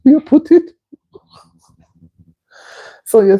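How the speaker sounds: high, cracking up mid-sentence; words swallowed by real giggles; laughing. Real, not acted. Host excusing the laughter to a viewer, laughing. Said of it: "It's not that I'm laughing at you".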